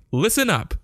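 high yell, pitched well up. A narrator's reading. Child to the businessman, a high call. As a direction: call out to grab attention. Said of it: "I yelled at the fat man".

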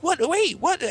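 high, panicked mock yelps; bewildered kid. Kid with no clue what happened, mock panicked squeal. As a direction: stammer it high in confusion.

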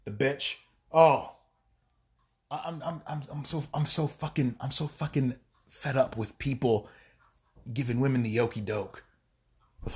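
ordinary talk, nothing marked

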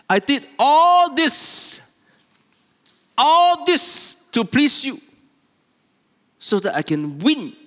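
high, loud, drawn-out protest; angry older son. Acted. Older son protesting all he did to please father, angrily. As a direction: hammer out the grievance, slow and loud. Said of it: "He was so angry".

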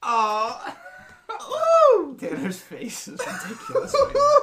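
shrieking high laughter while talking; loud laughter. Real, not acted. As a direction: howl with laughter. Laughing at Tanner's face.

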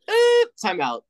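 sudden high yelped time out; mock Satan interruption. Imitating Satan cutting John off with a high yelp. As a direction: jump way up and cut him off.

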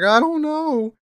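put-on high, tearful little-boy voice; small child voice. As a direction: whimper it like a scared ten-year-old. Voicing himself as crying child.

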